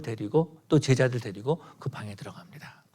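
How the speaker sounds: breathy hush, barely voiced; hushed whisper. A narrator's reading. Preacher narrating Jesus entering the dead girl's room, hushed whisper.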